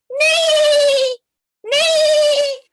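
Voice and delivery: a high, loud whinny; horse neigh. Pretend. Horse puppet answering the teacher, high loud neigh. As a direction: call back like a horse far away.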